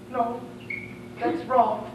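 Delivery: ordinary talk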